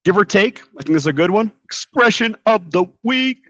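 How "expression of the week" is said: playful announcer. Pretend. Playful segment title announcement.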